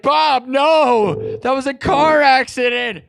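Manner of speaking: high, loud shout of protest. Retold or imitated. The speaker yelling at Bob Ross about the car crash, high loud protest. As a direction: yell it at him in disbelief. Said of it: "I was like".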